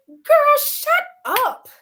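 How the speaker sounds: high, loud shriek of disbelief; a loud, high outburst of disbelief at a friend; incredulous "girl, shut up". Retold or imitated. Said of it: "And I said it just like that".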